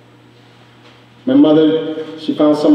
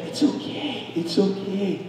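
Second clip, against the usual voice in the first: much softer, almost breathed, it's okay; mother soothing her angry child, softly; mom's hushed reassurance; 13 dB quieter than usual. Retold or imitated. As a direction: gently whisper it's okay to calm her. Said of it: "she was like".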